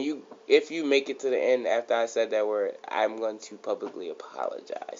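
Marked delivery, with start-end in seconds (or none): 2.9-5.0 whisper. Real, not acted. Whispered aside about an apology.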